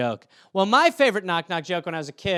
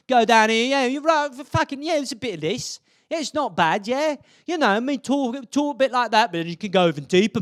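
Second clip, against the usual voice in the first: rough English street accent; slummier British accent; 2 semitones higher than usual. Shown as a demonstration. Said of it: "you could get a bit slummier".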